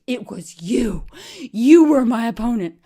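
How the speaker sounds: loud, slow, high shriek; betrayed friend shrieking, loud and high. Retold or imitated. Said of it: "She shrieked".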